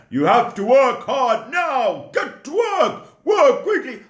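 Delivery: high, harsh slave-driver voice; bossy taskmaster. Acted. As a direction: bark orders at the slave. Egyptian taskmaster bossing a slave.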